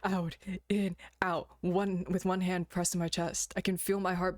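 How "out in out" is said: slow in and out breaths; deep breathing. Acted. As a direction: breathe deeply between words. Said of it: "I breathe deeply".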